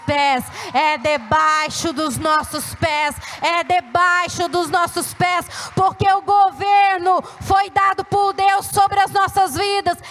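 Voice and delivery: slow, emphatic proclamation climbing in pitch and volume; forceful preaching. Real, not acted. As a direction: drive each phrase home, building with authority.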